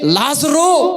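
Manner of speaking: high retold summons; imitated call to someone far away; loud calling. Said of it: "అని బిగ్గరగా పిలిచాడో".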